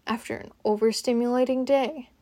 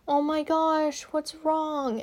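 higher-pitched mock concern; imitated concern; 6 semitones higher, slower than usual. People reacting to her crying, imitated concern. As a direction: imitate someone fussing over a crying person, pitched up.

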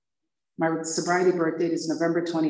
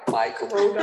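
mock billboard; 4 semitones higher than usual. Billboard tempting her.

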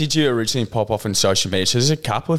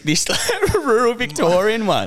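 high, drawn-out laughing protest; 12 semitones higher, slower than usual. Real, not acted. Guest defending their own accent, laughing. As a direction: say it slowly through a rising laugh.

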